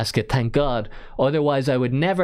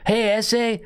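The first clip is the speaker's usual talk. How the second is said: higher-pitched put-on Mexican accent; mock Mexican accent; 8 semitones higher than usual. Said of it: "That's just how Mexican people talk".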